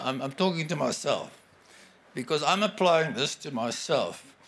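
ordinary talk, nothing marked

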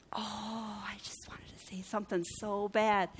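hushed, drawn-in whisper of temptation; very soft, confiding whisper; straining whisper. Acted. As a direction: whisper it like an urge barely held back. Confessing a held-back urge to retort.

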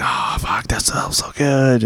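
husky aroused whisper, slow; whispered quote. Retold or imitated. Retelling his partner's hushed aroused murmur. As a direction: breathe it out like he was turned on.

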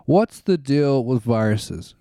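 normal talking voice

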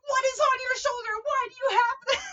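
high, loud hammed-up freak-out; losing his mind. Man dragged away from the woman whose bra strap he saw, hysterical. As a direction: shriek it in mock panic. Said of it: "he's losing his goddamn mind".

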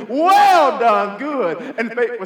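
loud proud call of the master; master praising. Retold or imitated. Master calling out praise to his servant, loud and proud. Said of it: "I can hear him say".

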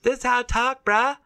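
exaggerated high-pitched surfer bro voice; voice going up. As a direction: push the surfer lingo way up high. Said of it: "does your voice have to go up when you start talking like that".

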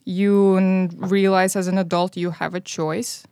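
usual speaking voice

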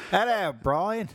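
a hint of a Cockney girl greeting; teasing Cockney girl voice; mock Cockney girl. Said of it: "like, the girl".

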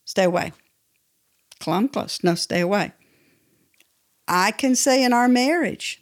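ordinary talk, nothing marked

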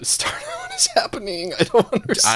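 squeaky high laugh into words; laughing disbelief. Real, not acted. Host cracking up at chat's lore, high laughing confusion. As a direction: laugh out the question, lost.

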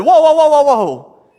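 high, loud alarmed whoa, whoa; mock outcry. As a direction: cut Jesus off with a loud, high protest. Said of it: "And people would have said".

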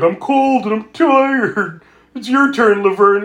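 high, drawn-out imitated whine; hammed-up cold-and-tired griping; mock complaining. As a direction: whinge it like a tired boyfriend. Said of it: "Randy's complaining".

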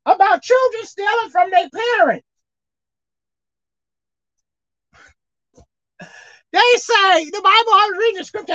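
high, loud fervent exhortation; preaching hard. Acted. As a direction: cry it out to the church, pitch climbing. Preacher rails against thieving family.

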